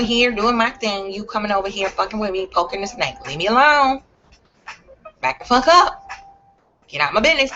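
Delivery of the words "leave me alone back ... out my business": a sharp, louder, higher leave me alone; mock warning yell. To trolls poking her, a loud warning. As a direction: bark it like a snake rattling.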